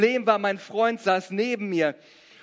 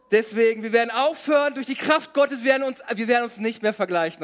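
preaching at full force; 2 semitones higher than usual. Acted. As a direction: drive the promise home to the church. Preaching climax.

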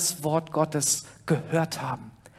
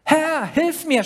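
much higher, slow cry for help; desperate cry; 10 semitones higher, 4 dB louder, slower than usual. A narrator's reading. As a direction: cry out while sinking. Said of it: "schrie er". (verzweifelter Hilferuf, viel höher und lauter)

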